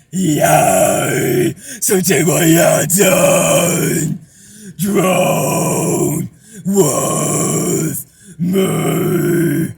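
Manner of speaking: slow, loud rough high scream. Shown as a demonstration. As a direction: stretch the words into a rough scream. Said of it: "do the higher ones".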